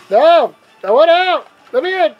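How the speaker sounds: loud panicked yells; shouting. Real, not acted. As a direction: yell to be let out. Stuck, yelling at the game.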